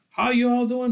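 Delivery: a quick Southern US "how you all"; Southern US accent. Retold or imitated. Lecturer doing a light Southern US accent to show American dialects. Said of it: "from the South".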